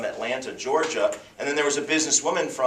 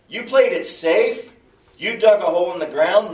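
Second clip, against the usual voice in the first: rebuking master; 6 semitones higher, 7 dB louder than usual. Retold or imitated.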